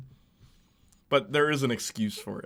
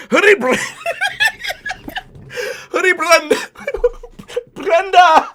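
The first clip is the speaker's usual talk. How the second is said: high, loud hammed-up Indian accent through laughter; 10 dB louder than usual. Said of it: "Puts on that accent".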